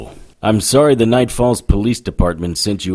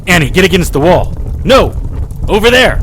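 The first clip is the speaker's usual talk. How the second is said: sharp alarmed yells, much higher and louder; urgent warning shout; 6 semitones higher, 5 dB louder than usual. Acted. Harry yells at little Annie to get away from the edge, alarmed shout. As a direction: shout it high and loud, she must move now. Child near ledge, Harry warns.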